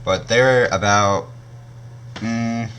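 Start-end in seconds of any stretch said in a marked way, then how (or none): none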